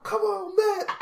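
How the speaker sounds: high loud cheer at the screen. Retold or imitated. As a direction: yell it on like a fan. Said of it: "I'm like".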